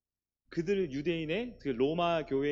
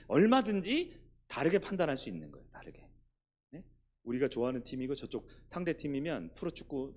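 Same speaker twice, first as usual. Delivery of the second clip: ordinary talk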